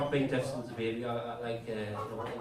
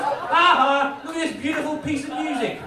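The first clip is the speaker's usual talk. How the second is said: loud, high taunting shout; the subtitles taunting the deaf viewer, loud and high; mocking subtitles; 13 semitones higher, 9 dB louder than usual. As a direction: jeer like a bully. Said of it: "it's just".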